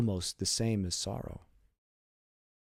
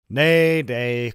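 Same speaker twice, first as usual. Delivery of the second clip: cried out smiling; 3 semitones higher, 11 dB louder than usual. A narrator's reading. Caderousse teasing the groom across the wedding table, a smiling loud cry. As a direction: laughingly cry out to stop him. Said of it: "cried Caderousse, smiling".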